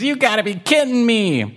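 high, fed-up kitten; mad kitten. Acted. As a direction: complain like a frustrated kitten. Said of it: "Waffles is really mad".

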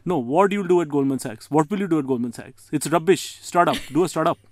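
a hint of an uncle dismissing a career; mock Indian uncle telling his son to do a startup; Indian uncle accent. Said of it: "an Indian uncle will say stuff like".

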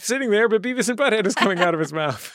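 high, delighted gushing, quick at the end; amazed fan. Retold or imitated. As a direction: gush like a thrilled fan hearing Beavis. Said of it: "and you're like".

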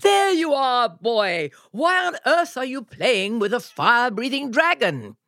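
father calling out. Acted. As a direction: shout at his son in surprise. They heard a shout.

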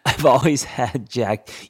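slow careful retake over the lisp; slow down to get the words out. Real, not acted. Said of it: "help me speak slower".

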